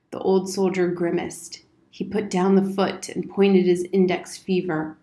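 usual speaking voice

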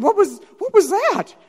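high, loud, baffled reaction; bewildered. Retold or imitated. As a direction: squeak it out in disbelief. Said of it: "I'm, like, bewildered".

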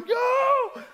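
a high yelp of panic; high mock yell. Boy running from the water, high mock yell. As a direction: shout it while scrambling out.

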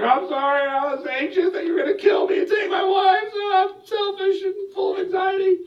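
high, frantic pleading cry; panicked Abraham. Retold or imitated. Abraham confessing to the angry king, frantic and high. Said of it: "And Abraham's like".

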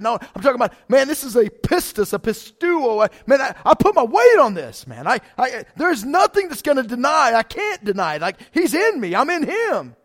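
loud, high, pounding sermon cry; full-force preaching. Acted. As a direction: preach it out with everything.

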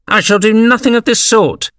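high-pitched, indignant refusal. A narrator's reading. Mouse refuses Alice's help, indignant and high.

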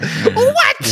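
high laughing disbelief; shocked what. Real, not acted. Shocked at the word's meaning.

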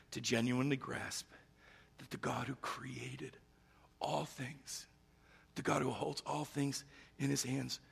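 soft, reverent reflection with long pauses; hushed preaching. Real, not acted. Preacher dwelling on Jesus washing feet, hushed. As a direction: let each phrase land quietly, pausing between them.

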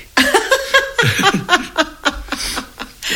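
long high delighted laugh. Real, not acted. Recalling a film joke, a long high laugh.

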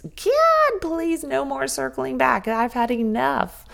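God cried out high, then the rest slow and drawn out; a mock prayer for the meetings to stop; exasperated plea. Fed-up working mom begging to God to stop circling back, slow exasperated plea.

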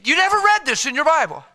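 high, loud rhetorical jab; mocking preacher's shout; loud challenge. Real, not acted. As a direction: throw it at the crowd. Preacher exclaiming to congregation.

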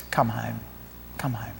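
hushed, low invitation; quiet, gentle coaxing; tender appeal. Real, not acted. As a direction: drop to a soft, low plea. Preacher tenderly appeals to listeners.

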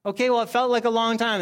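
playful excuse for being impatient; sheepish defensive excuse. Retold or imitated. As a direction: brush it off in a rush, mock whiny. Defending his impatience at door.

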